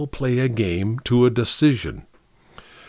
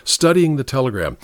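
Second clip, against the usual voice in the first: usual speaking voice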